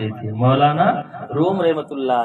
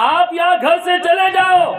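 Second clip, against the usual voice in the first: much higher and louder, yelling the repeat; furious shout; 4 dB louder than usual. Acted. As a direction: bellow it to drive him out. (بیٹا غصے میں باپ پر زور سے چیختا ہے)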